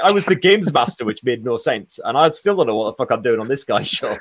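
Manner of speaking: ordinary delivery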